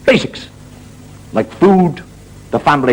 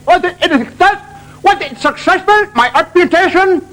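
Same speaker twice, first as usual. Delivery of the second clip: put-on German accent. German patient agreeing to lose his leg, comic accent. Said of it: "He said".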